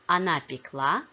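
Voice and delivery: пекла with the last syllable stressed, пекЛА; stress on ending. Shown as a demonstration. Teacher models the feminine past of печь, stress on ending. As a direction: hit the final ЛА in пекла. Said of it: "the stress shifts to the ending".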